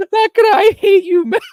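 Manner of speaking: high, loud words through laughter; laughing protest. Real, not acted. Host teased by his friend over bad accent, laughing protest. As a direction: crack up while declaring mock hatred.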